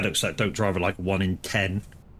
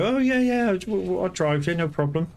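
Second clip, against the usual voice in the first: bright, much higher mock-cheerful voice; the wife agreeing to drive, mock eager and higher; imitating the eager wife; 10 semitones higher than usual. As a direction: agree all too eagerly. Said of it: "they go".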